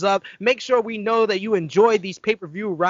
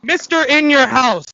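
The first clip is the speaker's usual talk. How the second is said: loud, higher proclamation; mock announcer call; 5 semitones higher, 7 dB louder than usual. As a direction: hail him like a ring announcer. Host proclaims a nickname.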